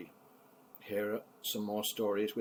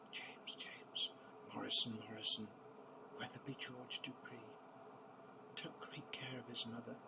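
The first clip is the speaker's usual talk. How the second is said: much softer, half-whispered verse; hushed reading; 2 semitones higher, 11 dB quieter than usual. Acted. Grandpa hushing the last verse for the sleepy grandkids. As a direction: drop to a hush as the poem ends.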